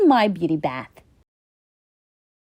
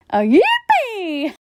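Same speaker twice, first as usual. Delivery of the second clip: excited cheer; 8 semitones higher, slower than usual. Acted. Donkey delighted to join bath.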